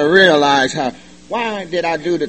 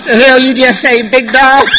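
mock clerk retort; 7 semitones higher, 7 dB louder than usual.

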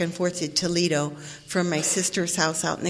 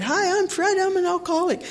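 a higher-pitched, hammed-up AA introduction; mock meeting greeting; 11 semitones higher than usual. First man sharing at the meeting, hammed-up high greeting. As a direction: lightly parody the earnest newcomer. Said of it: "the first guy that shared said".

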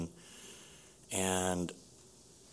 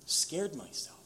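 ordinary talk

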